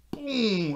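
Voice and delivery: loud high "boom"; booming punch sound effect. Retold or imitated. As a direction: shout the punch noise.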